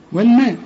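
"والماء" with a thin م, as in plain speech; light meem. Shown as a demonstration. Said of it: "قول لي والماء". ("والماء" بميم مرققة)

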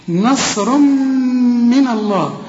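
slow Quran recitation of نصر من الله; recited Quran. Shown as a demonstration. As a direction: chant نصر من الله slowly in tajweed style. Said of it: "لسه بقول لك رققها".

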